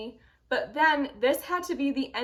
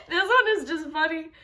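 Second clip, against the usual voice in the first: amused, higher voice through laughter; laughing; 4 semitones higher, 4 dB louder than usual. Real, not acted. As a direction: laugh while saying it. Laughing at the next review.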